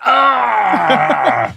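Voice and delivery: high yell at the closed door; hammed-up Viking yell; frustrated shout. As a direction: cry out in mock frustration. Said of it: "be like".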